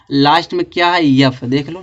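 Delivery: usual speaking voice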